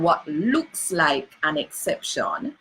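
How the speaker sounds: ordinary talk, nothing marked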